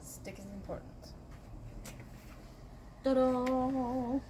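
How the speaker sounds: normal talking voice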